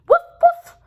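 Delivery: high, loud yappy woofs; squeaky puppy woof-woof; puppy bark. Acted. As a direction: bark like a happy little puppy. Puppy barks in the story.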